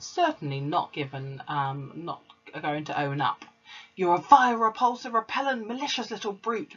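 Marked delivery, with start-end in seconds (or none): 4.0-6.8 high, loud headmistress bellowing insults; towering fury, voice cracking up high; furious shout. A narrator's reading. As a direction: rage at the girl, spitting each insult. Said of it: "the Trunchbull was shouting".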